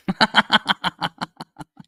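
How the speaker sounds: a loud, high-pitched laugh at his own joke; high burst of laughter. Real, not acted.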